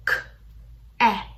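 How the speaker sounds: "k" and "at" said as separate sounds; sounding out words. Shown as a demonstration. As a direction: split "k, at" into two crisp sounds. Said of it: "we will sound out".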